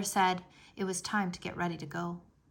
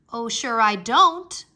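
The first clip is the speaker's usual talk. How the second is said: louder, slow stubborn comeback; defiant child; 2 semitones higher, 12 dB louder, slower than usual. A narrator's reading. Junie B sasses her mother, a slow loud defiant comeback. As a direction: dig in your heels, slow and loud.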